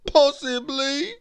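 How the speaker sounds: high, sobbing mock-mournful voice; hammed-up weepy answer; tearful reply. A narrator's reading. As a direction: stammer it out through pretend sobs. Weeping Mister Nobody answers.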